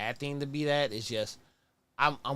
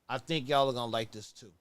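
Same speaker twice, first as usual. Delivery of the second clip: normal talking voice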